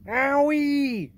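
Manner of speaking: very high, very loud giant yelp; pained yell. Acted. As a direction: yell out in sudden pain. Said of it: "yelled the B F G".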